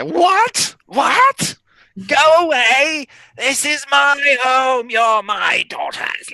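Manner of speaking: high, shouting hag voice; furious hag. Acted. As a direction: scream like an enraged hag. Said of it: "she just seems to get more and more irate".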